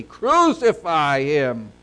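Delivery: loud, slow acted crowd cry; emphatic, declaimed outcry. A narrator's reading. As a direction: cry it out forcefully, drawing out each word. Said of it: "they cried out more exceedingly".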